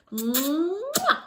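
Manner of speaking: high smacking mwah; big playful kiss; loud kiss smack. Acted. Kiss sound.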